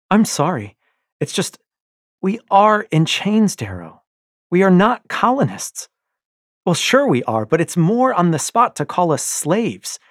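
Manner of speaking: loud, high, pleading and bitter; impassioned young wife. Acted. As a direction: rebel wife rails against slavery, loud and bitter. Io's heated plea.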